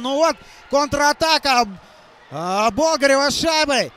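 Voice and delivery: loud, drawn-out goal shout; excited hockey goal call. Real, not acted. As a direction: roar it out as the puck goes in. Goal scored, commentator erupts.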